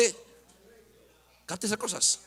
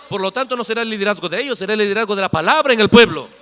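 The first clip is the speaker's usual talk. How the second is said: raised voice building to a shout; forceful preaching; 3 semitones higher, 10 dB louder than usual. Real, not acted. Preacher declaring who leads the people, forcefully. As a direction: proclaim it to the whole congregation, building louder.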